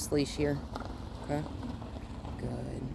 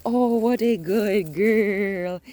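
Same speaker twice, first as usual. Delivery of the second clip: higher, louder, slow, drawn-out gush; gushing praise; 6 semitones higher, 6 dB louder, much slower than usual. Real, not acted. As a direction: burst into delighted praise for the dog. Excited praise of the dog.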